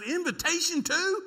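high, slow, disbelieving squeak; aghast question. Retold or imitated. Son asking dad about the service, high and aghast. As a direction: ask it in horrified disbelief.

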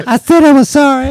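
high, loud whiny apology; pleading henchman. Acted. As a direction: whine it like a cowering servant.

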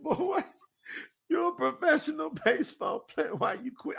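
high-pitched, incredulous questioning; mock-upset grandmother voice; imitated Southern grandma. Voices his grandmother questioning him.

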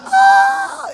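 sudden high yelp of pain. Retold or imitated. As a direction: yelp as the hair gets pulled. Leg hair pulled by daughter.

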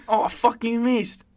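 high-pitched mock Kiwi surfer; hammed-up Kiwi accent for the surfer; New Zealand accent. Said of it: "a good New Zealand accent".

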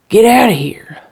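loud, high, frantic order; wild shout. Acted. Erratic husband wild-eyed at the window, shouting. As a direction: burst out shouting to drive them away.

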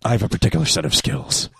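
low, quiet movie-tough-guy threat; Liam Neeson. Acted. Host mimicking the film phone threat, Liam Neeson impression. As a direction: drop it low and soft, like an action hero. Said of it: "like, Liam Neeson or anything like that".

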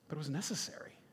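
hushed, weighty aside to the congregation; hushed emphasis. Real, not acted. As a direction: drop to a near whisper. Hushed dramatic turn after pause.

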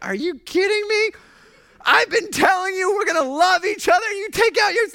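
high, loud, exasperated shout; exasperated Jesus. Retold or imitated. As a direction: shout it in disbelief at a friend. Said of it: "Jesus is like".

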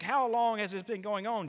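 panicked. Pretend. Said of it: "in a panic".

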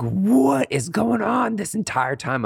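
high, drawn-out disbelief; acted-out baffled inner cry; exasperated. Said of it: "I was like".

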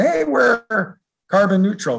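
high, drawn-out mock announcement; mock boasting. Imitating data centers that just buy offsets, mock boastful.

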